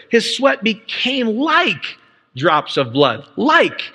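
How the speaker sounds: slow, emphatic delivery with "like" held; stress on like. Shown as a demonstration. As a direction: lean hard on "like", stretching it. Insists the word is figurative.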